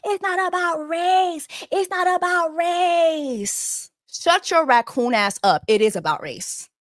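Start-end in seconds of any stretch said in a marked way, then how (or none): 0.1-3.9 mocking whiny denier. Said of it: "y'all be in my".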